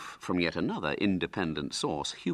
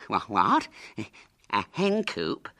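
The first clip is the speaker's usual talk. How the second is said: startled disbelief; 4 semitones higher, 4 dB louder than usual. A narrator's reading. Hubert hears of the spell.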